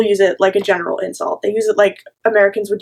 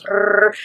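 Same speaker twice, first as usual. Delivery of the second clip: long trilled R like a motor; rolled R growl. Retold or imitated. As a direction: imitate an angry sister's revving rolled R. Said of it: "she starts rolling her R's".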